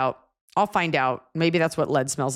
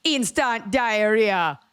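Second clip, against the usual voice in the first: put-on slow, drawn-out Jamaican accent, higher; 5 semitones higher, much slower than usual. Nanny hitting the phrase hard in Jamaican accent. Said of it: "really do it with the accent".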